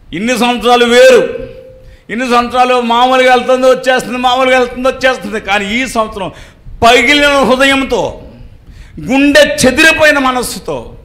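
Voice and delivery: full-force sermon voice; fervent preaching. Acted. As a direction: preach it out to the congregation. Preacher tells Hannah's grief.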